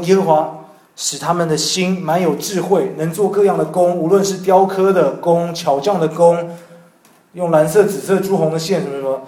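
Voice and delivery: scripture reading. Retold or imitated. Reading the Bible verse aloud.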